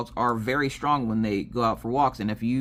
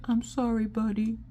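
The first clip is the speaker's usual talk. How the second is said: put-on high, soft kid voice; apologetic child; 10 semitones higher, 4 dB quieter than usual. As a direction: imitate a kid sweetly apologizing to a dog.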